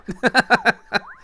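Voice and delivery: high, loud burst of giggling; high giggle. Real, not acted. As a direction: giggle at the silly noise. Laughs at the sound.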